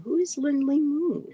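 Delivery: high-pitched, baffled question; puzzled surprise. Real, not acted. Streamer asking who an unknown chat name is, puzzled surprise. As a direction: ask it high, genuinely thrown by the name.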